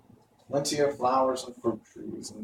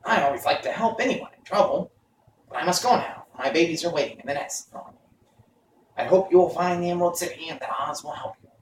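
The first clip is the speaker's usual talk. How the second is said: higher bird voice; a sharp-eyed stork, pitched up; 6 semitones higher, faster than usual. A narrator's reading. Stork correcting the travellers, higher voice. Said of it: "said the stork".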